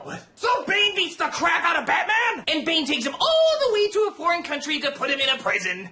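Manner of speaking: ordinary talk, nothing marked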